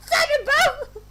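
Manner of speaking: high voice cracking into laughter; giggling through a high girl voice; laughing. Acted. Laughing while voicing tiny girl.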